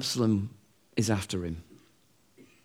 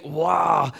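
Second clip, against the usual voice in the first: mock outcry; 3 semitones higher than usual. Mocking online commenters' outcry.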